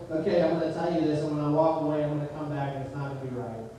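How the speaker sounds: low voice, sinking and fading away; resigned inner thought. Retold or imitated. Instructor privately doubting the learner, low resigned aside.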